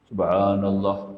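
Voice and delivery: drawn out slowly; slow careful recitation. Shown as a demonstration. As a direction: linger over each sound. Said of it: "Macam-macam pelan-pelan".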